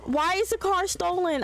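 high-pitched, demanding father; agitated mock yell. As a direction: demand an answer from your daughter. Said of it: "can he scream at her".

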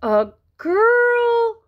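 high, loud incredulous outcry; young frog's outraged squawk; dismayed cry. Acted. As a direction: cry out in disbelief, pitch leaping up. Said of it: "cried Froggy".

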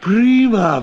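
high, loud burst of delight; gleeful shout. Acted. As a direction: cheer at the money. Gets money for sausages.

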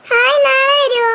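put-on high delighted little-kid voice; excited child. Child told father comes tomorrow, excited. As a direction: pipe up high with sudden hope.

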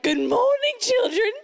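high, singsong British mummy; British accent. Acted. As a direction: greet them in a hammed-up British trill. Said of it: "in the future I'd be British".

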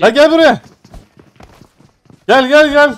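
loud high shout; calling out loudly. Real, not acted. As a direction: shout at them to come. Calling enemy over, loud.

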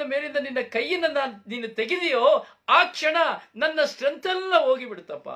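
loud, high, tearful wail of a broken son; crying son. Acted. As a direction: plead through tears to your father. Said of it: "ಮಗ ಅಳಲಿಕ್ಕೆ ಪ್ರಾರಂಭ ಮಾಡ್ತಾನೆ". (ಅಳುತ್ತಾ ಅಪ್ಪನಿಗೆ ಜೋರಾಗಿ ಹೇಳುವ ಮಗ)

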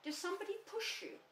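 very high, eager little voice; excited. A narrator's reading. Roo asking whether somebody pushed Eeyore, excited. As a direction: squeal the question with childlike eagerness. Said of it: "said Roo excitedly".

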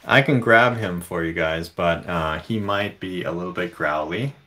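usual speaking voice

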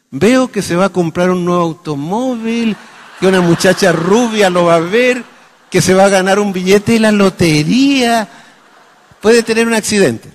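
high, loud prophetic chant; fortune-teller. Acted. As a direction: proclaim each prediction like a seer. Doctor acts as coffee-grounds fortune teller. (adivino que proclama sus visiones)

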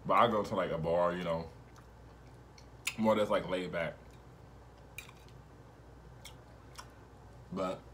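slight food in mouth; casual eating between words; talking while chewing. Real, not acted. Seen 0: Bites pasta at -3s, twirls forkful throughout, likely still chewing.

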